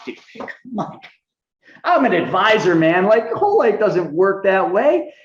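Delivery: high, cocky dismissal, slow at the end; know-it-all scoff. Retold or imitated. Telling Mike whole life doesn't work, with cocky disbelief. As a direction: brush him off like the expert. Arguing as advisor, retold.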